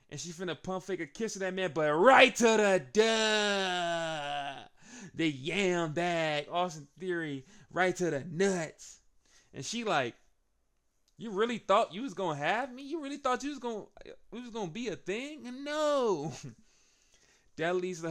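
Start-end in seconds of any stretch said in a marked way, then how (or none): 1.7-9.0 slow loud shout, pitched up; excited shout. Real, not acted. Low blow, shouted. 11.2-16.3 high, pitched-up sneer; mocking taunt. Maxine taunting Theory, high mocking voice.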